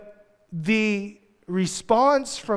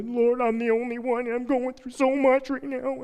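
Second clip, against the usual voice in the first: high, pleading whine; whiny Elijah; 5 semitones higher, 6 dB quieter than usual. Retold or imitated. Elijah complaining to God, high and whiny. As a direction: whine like a self-pitying prophet. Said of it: "When you read about Elijah".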